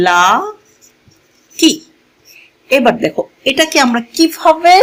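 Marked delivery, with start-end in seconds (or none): 0.1-1.7 LA, long pause, KI; broken into syllables. Shown as a demonstration. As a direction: say লা, hold off, then add কি. Spells out word while writing.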